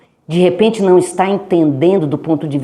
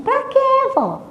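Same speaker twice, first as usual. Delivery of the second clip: much higher, soft question; girl asking grandma why; child voice; 4 dB quieter than usual. Retold or imitated. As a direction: ask it like a curious kid.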